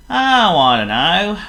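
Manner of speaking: loud, high, cheery Clumsy voice; a careless guest answering with a happy grin; grinning guest. Acted. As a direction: grin through it, carefree. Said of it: "grinned Mister Clumsy".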